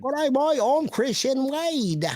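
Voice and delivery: bad British accent, pitched high and drawn out. Shown as a demonstration. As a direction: put on a mock British accent, high and slow. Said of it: "That's his British accent".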